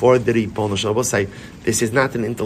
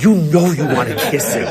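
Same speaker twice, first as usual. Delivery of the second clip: laughing coaxing. Retold or imitated. Coaxing friend to kiss stones.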